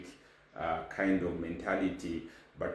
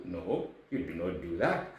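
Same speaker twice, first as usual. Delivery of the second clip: normal talking voice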